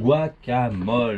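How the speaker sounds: spelled-out slowly; slow and clear. Shown as a demonstration. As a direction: say it slowly so they catch it. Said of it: "Un quoi?".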